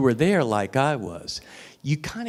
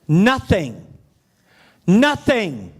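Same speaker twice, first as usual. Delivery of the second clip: emphatic nothing; 5 semitones higher, 5 dB louder, much slower than usual. Real, not acted. Emphatic repeated word, louder higher.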